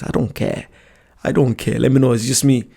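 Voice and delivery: ordinary delivery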